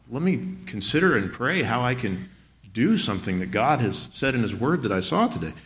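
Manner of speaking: normal talking voice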